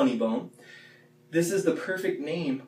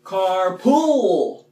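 high, loud carpool; punchy carpool; 7 semitones higher, 6 dB louder than usual. Shown as a demonstration. Teacher showing how to say carpool. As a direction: hit the word carpool high. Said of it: "And carpal".

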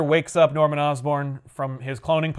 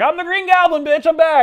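much higher, louder, hammed-up cackling villain voice; gloating villain; 13 semitones higher, 8 dB louder than usual. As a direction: announce the comeback like a cartoon goblin. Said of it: "He's like".

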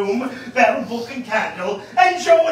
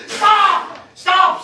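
shouting stop; 10 semitones higher, 6 dB louder than usual. Acted. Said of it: "says Joe".

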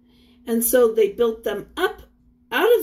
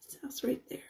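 whisper; 14 dB quieter, much faster than usual. Real, not acted. Teacher pointing at picture.

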